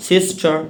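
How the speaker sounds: সিস্টার ending on an open vowel; sister, non-rhotic ending; final R dropped. Shown as a demonstration. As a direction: say সিস্টার with the last R silent. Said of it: "এগুলোতে রায়ের উচ্চারণ হবে".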